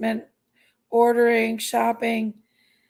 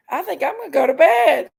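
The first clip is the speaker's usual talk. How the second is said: usual speaking voice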